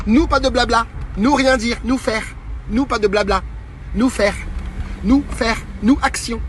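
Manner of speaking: high, loud broken-French caveman talk; caricature voice. Pretend. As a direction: bark short words like a brute. Echoes the caricature slogan. (voix de caricature)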